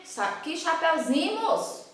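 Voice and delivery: play-scold an imagined pupil; mock indignation. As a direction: pretend exasperated teacher.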